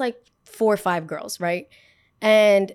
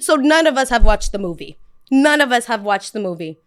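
indignant; 3 semitones higher, 8 dB louder than usual. Retold or imitated. Indignant retort.

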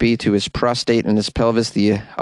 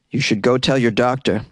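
ordinary delivery

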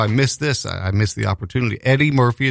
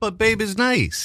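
pleading; 9 semitones higher, slower than usual. Pretend. Pleading teasing protest.

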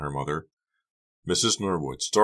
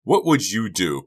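loud, higher challenge; exasperated teen cry; 5 semitones higher, 6 dB louder than usual. A narrator's reading. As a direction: throw the question back heatedly. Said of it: "Becca cried".

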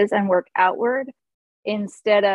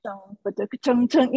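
chugging vocal guitar riff; imitated strumming rhythm. Shown as a demonstration. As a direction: punch out chords as spoken syllables.

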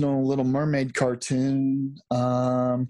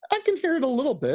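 put-on middle Atlantic accent; 8 semitones higher, faster than usual. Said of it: "that New York sort of accent".

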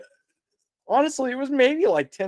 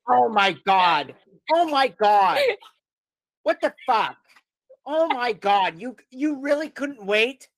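angry mom; 5 semitones higher than usual. Retold or imitated. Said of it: "She was so angry".

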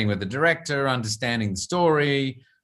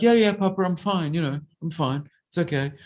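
higher, lightly voiced child brushing it off; a boy reassuring his worried papa, higher; little son's voice; 5 semitones higher than usual. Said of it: "And he's like".

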